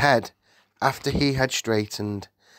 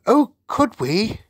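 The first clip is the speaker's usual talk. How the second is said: put-on high, slow, louder girlish delight; eager cry; 13 semitones higher, 5 dB louder than usual. A narrator's reading. Sophie answers the Queen, eager high cry. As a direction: leap at the offer. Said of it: "Sophie qu-, cried".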